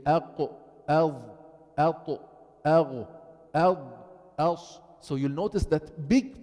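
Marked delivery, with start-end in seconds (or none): 0.1-4.8 أق أض أط أغ أض أص each with a stopped, heavy, full-mouthed letter; heavy letter drill. Shown as a demonstration. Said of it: "You say".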